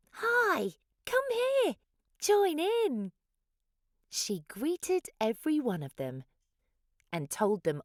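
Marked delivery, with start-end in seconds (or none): 0.1-3.1 much higher, louder chorus of tiny dinosaurs; squeaky welcoming invite; high little dinosaurs. A narrator's reading. Little dinosaurs inviting Hugosaurus to play, high and eager. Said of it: "all the little dinosaurs said".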